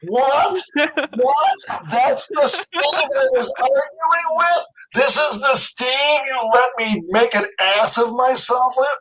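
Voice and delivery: high, slow, incredulous what; reliving the shock of the reveal; hammed-up dumbfounded squeal; stunned disbelief. Retelling his shocked reaction to Spielberg.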